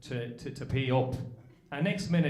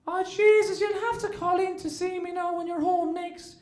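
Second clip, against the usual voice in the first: put-on much higher gushing old aunt; 12 semitones higher than usual. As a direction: coo it like a fussing aunt. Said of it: "she turns around and says to me".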